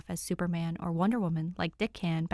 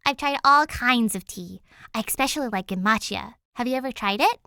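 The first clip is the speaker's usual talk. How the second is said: put-on louder, bright eager girl voice; eager chatter; 4 semitones higher, 7 dB louder than usual. Danny chattering to Alfred, eager and loud. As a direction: bubble over about tea. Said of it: "as she continues".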